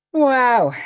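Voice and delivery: loud, high exclamation of delight; impressed wow. Real, not acted. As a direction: burst out amazed at a lovely scent. Reacting to a nice scent.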